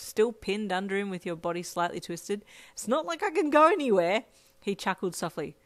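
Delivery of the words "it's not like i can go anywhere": loud, high protest; exasperated yelp. A narrator's reading. Pinned and unable to move, loud exasperated protest. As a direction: complain loudly in a raised pitch. Yelped retort continues while pinned.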